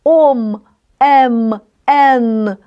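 "ом", "ем", "ен" said clearly one by one; old spellings read out. Shown as a demonstration. Teacher listing old spellings for the class, clearly. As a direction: list the old doubled spellings. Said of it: "таким подвоєнням".

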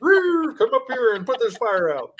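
calls out high, playfully pretending to shout for help; mock call for help. Host jokes about a fire upstairs, high mock call. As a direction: pitch it way up like a fake rescue call. Joke quote calling the fire department.

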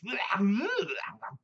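high, loud cartoon devil yowl; Tasmanian Devil snarl. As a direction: let rip a wild spinning beast cry. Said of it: "he was the Tasmanian Devil".

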